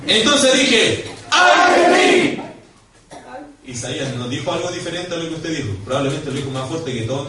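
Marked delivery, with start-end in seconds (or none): 0.1-1.0 high, loud lead-in; ringing pulpit call; shouted cue. Shown as a demonstration. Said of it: "ahora grite como que estuviera asustado". 1.3-2.4 very high, loud, long scream; drawn-out shriek of fright on request; frightened scream. Shown as a demonstration. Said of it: "grite como que estuviera asustado".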